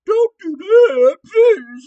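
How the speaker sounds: mock whine like a sobbing crybaby, slow; sarcastic fake-crying plea. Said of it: "I'm just gonna cry like a crybaby".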